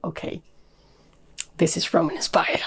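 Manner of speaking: hushed, breathy aside to herself; whispered realization. Retold or imitated. Reviewer recalling her reaction to the book, whispered. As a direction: whisper it like a private discovery. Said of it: "but then I was just like".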